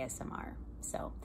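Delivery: ASMR whisper. Real, not acted. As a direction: breathe the word softly. Talking about ASMR sounds.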